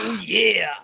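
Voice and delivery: Macho Man impression. Co-host answering with Macho Man shout. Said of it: "That's Macho Man glasses".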